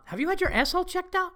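high, fast deadpan ask; cold executive concern; dead serious question. Retold or imitated. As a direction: ask like a boss about to fire someone. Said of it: "He asked it as if a C E O".

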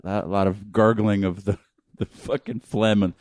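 ordinary talk

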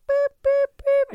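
high-pitched electronic boops; sing-song high boop noises; imitated dial-up beeps. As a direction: mimic a modem dialling with spaced beeps. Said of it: "and be like".

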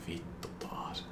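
breathy whisper under the breath; whispered aside. Retold or imitated. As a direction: whisper it, deflated. Girl looks down, disappointed. (kuiskattu pettynyt huokaus)

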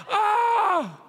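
high, drawn-out "oh" falling away; mock crying wail. Preacher imitating himself crying in church, high mock wail. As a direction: wail as if overcome by the song.

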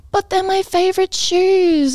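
high, drawn-out mock whine; whiny protest. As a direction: imitate a customer complaining, slow and high. Mum protesting about her stilettos.

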